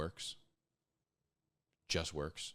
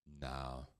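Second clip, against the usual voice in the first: a lower, softer sheepish no; mumbled no; 6 semitones lower, 8 dB quieter than usual. Pretend. Lazy guy admitting to the questioner he isn't fast, mumbled. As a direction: mumble it, caught out.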